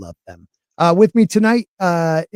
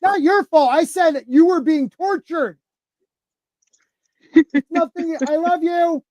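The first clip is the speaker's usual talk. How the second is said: calling up reassurance; 9 semitones higher than usual. Real, not acted. Reassuring kids upstairs.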